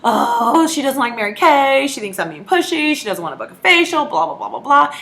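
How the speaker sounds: high, loud, fretting self-talk; anxious inner worry. Retold or imitated. A consultant fretting in her head after a friend says no. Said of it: "We internalize everything".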